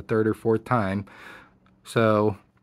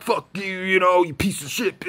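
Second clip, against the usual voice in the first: higher, mock rude outburst; mock angry cussing; 8 semitones higher than usual. Contrasting a rude shout with what he said, mock anger. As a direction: pretend to cuss him out. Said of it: "but not like".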